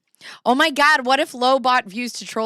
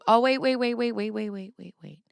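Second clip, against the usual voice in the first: urgent wait fading; 2 semitones higher than usual. Real, not acted. Noticing she already read this, wait fading. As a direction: catch yourself, then fade out. Stops herself, fading repeated waits.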